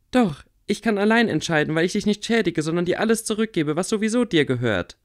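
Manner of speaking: put-on a stubborn girl heatedly laying out her logic; Theresa, insistent. A narrator's reading.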